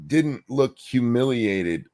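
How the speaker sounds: usual speaking voice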